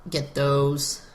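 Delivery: ordinary delivery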